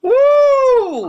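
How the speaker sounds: loud, high celebratory woo; high-pitched whoop. Acted. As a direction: let out a shrill party whoop. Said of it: "when I make that high-pitched noise".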